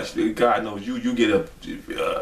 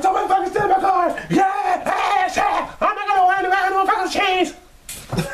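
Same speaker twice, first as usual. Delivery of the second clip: mock drunk yell. Mimicking the drunk dad yelling.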